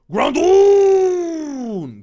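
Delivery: high, long, loud announcement of the name; drawn-out battle cry; summoning cry. Acted. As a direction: bellow the monster's name and hold it. Calls out summoned Pokemon's name.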